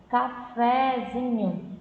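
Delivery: slow demo of cafezinho's two strong syllables; secondary stress on FÉ. Shown as a demonstration. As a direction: lean briefly on FÉ, then on ZINHO. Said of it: "ele realmente se prolonga um pouquinho".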